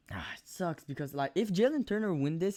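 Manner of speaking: usual speaking voice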